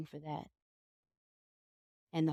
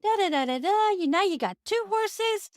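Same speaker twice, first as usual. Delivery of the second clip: much higher, loud gushing envy; gushing neighbor; 14 semitones higher, 4 dB louder than usual. Acted. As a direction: squeal over the neighbor's blessings. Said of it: "The neighbor comes over and goes".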